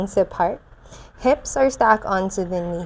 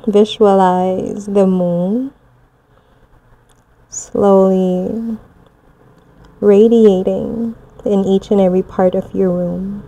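guided meditation; 4 dB louder than usual. Acted. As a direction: guide them gently through the visualization. Guided moon visualization.

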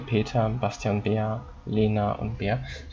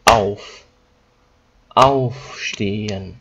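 "aufstehen" very slow, AUF punched louder; stress on AUF; 5 dB louder, much slower than usual. Shown as a demonstration. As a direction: hit "auf" hard in "aufstehen", weaker ending. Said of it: "strong pronunciation at the beginning".

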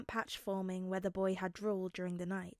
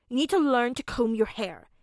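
bossy; 7 dB louder than usual. Acted. Bossy child scolding.

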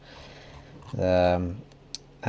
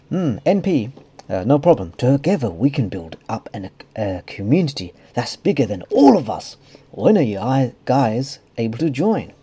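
swooping higher mock-friendly voice; put-on silly voice; 4 semitones higher, 4 dB louder than usual. Realm owner welcoming the group, silly put-on voice. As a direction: read it in a silly made-up character voice. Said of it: "putting a different voice for him".

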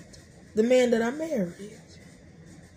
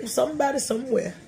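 ordinary talk, nothing marked

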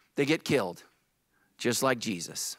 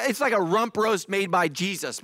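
ordinary talk, nothing marked

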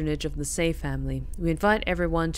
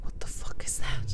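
whisper; 3 dB quieter than usual. Real, not acted. Whispered fright at a creature.

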